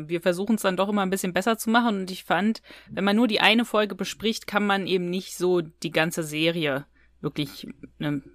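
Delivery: ordinary talk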